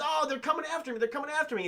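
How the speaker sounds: high-pitched imitation of fright; lightly hammed-up damsel alarm; mock panic. Chased woman telling Arnold about goblins, mock panic.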